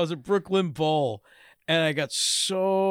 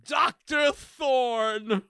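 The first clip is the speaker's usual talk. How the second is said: very slow, raised-pitch British; grandly drawn-out British show title; British accent; 5 semitones higher, much slower than usual. Acted. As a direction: stretch it out in a mock British accent. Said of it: "can't say these words without British accent".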